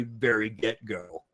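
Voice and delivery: ordinary talk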